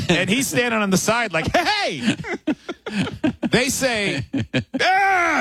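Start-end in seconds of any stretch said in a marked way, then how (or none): 1.5-2.1 excited hey. Retold or imitated. Producer Joe's greeting. 4.8-5.4 crowd cheer. Retold or imitated.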